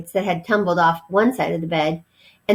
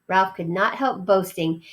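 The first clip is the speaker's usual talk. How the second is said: plain reading voice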